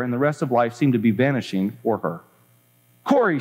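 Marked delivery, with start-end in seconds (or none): 3.0-3.4 higher, excited call. Acted. Betsy calls Corrie, higher and excited. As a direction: call the name with acted excitement. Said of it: "she said excitedly".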